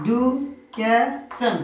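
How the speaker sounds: "Education" very slowly as ই-ডু-কে-শন; four separated beats in "education", pausing after each; split into four syllables. Shown as a demonstration. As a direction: sound out "education" one syllable at a time. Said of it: "আমরা চারবারের প্রচেষ্টায় উচ্চারণ করতে পারছি".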